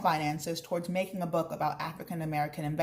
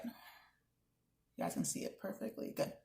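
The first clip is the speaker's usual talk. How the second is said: quiet aside; 12 dB quieter, slower than usual. Real, not acted. Teacher checks screen aside.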